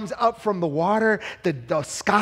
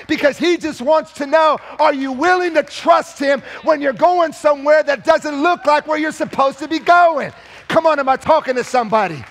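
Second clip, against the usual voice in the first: loud, high, driving sermon climax; preaching at full force; 5 semitones higher, 4 dB louder than usual. Acted. Preacher pressing the congregation, louder and higher. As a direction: lift the whole room with it.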